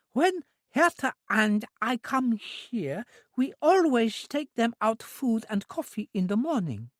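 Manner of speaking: put-on high, slow simple girl's voice; an eager, innocent young woman; high, drawn-out childlike voice; childlike Trudy. A narrator's reading.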